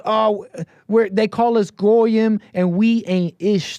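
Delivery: high, slow mock drawl; lightly voiced caller, stretched out.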